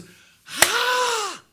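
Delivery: long, high acted "ah!"; gasp of realisation. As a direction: exclaim as a sudden revelation strikes. David's sudden moment of realisation. (높고 길게 터지는 깨달음의 탄성)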